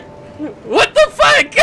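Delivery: high, slow, laugh-choked what the fuck; can't believe it, laughing; laughing disbelief. Real, not acted. Laughing at weird scene.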